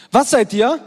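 rally the room, loud; loud call-out. Real, not acted. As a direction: call the question out to the crowd. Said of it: "ich will euch kreischen hören". (lauter Zuruf an die Menge)

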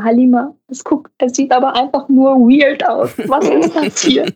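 mock the director's helpless whining; whiny director. Director complaining about the house. (Regisseurin imitiert, höher und quengelig)

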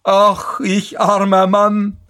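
high, drawn-out wail; lamenting cry. A narrator's reading. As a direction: stretch out the carter's self-pitying call. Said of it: "rief er wieder". (hoher, gedehnter Klageruf)

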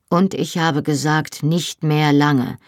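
plain reading voice

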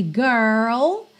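put-on GIRL with a strongly curled American R; drawn-out American "gurrrl". Shown as a demonstration. Teacher models American pronunciation, curled R in GIRL. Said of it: "a gente enrola mesmo o R".

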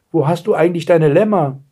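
high-pitched eager question; excited. A narrator's reading. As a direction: ask as an excited girl. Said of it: "rief Blaustrümpfchen aufgeregt". (aufgeregte Frage, hohe Stimme)

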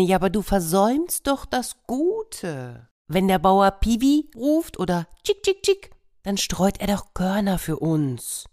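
high clucky hen voice. Acted. Hen scolding the peacock, high voice. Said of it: "sagte die Henne".

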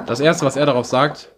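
usual speaking voice

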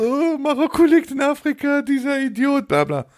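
very high, squeaky jeering voice; exaggerated high taunt, easing down at the end; mocking mimicry. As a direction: mimic them mockingly way up in pitch. Mocking quote inside a retold quarrel.

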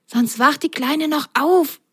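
high little character voice, cautioning; warning. A narrator's reading. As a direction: warn a friend in a high sock voice. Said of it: "warnte Ron".

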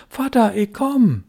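high, slow call across the ice; a boy calling to his father's boat. A narrator's reading.

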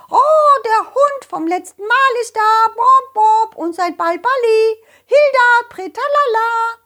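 high, loud squawking hen; flustered hen voice; excited hen. Acted.

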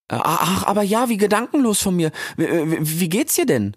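put-on high, halting young voice; timid Rix. (schüchterner Rix, hoch und stockend)

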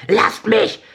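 high, loud desperate shriek. Acted. Boy fighting off the police, shrieking high. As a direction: scream it like a boy being torn away. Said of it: "kreischte der Junge".

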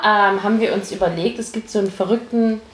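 ordinary talk, nothing marked

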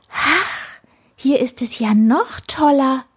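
high, delighted exclamation; jubilant. A narrator's reading. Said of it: "jauchzte er". (jauchzend vor Begeisterung)

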